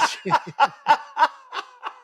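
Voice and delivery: high, loud laugh; burst of laughter. Real, not acted. Recalling his daughter's dig, a loud delighted laugh. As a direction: crack up at the joke.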